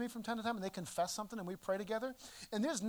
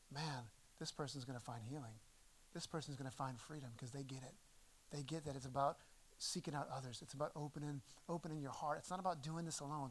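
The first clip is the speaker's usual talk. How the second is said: a much softer, hushed murmur to himself; quiet inner thought; 7 semitones lower, 11 dB quieter than usual. Retold or imitated. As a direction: think it aloud gently, full of hope. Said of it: "My first thought is to think".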